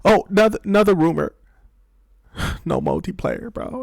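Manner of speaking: deflate into a defeated sigh; mock let-down sigh. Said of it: "And it's like".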